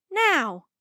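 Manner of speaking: high, loud one-word command; yelled order. A narrator's reading. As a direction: yell it as an urgent order. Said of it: "she yelled".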